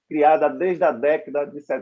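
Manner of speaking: ordinary talk, nothing marked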